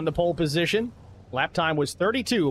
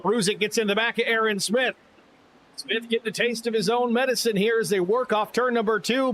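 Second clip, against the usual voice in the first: live play-by-play call; race caller; 5 semitones higher than usual. Acted. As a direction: call the race like a track announcer.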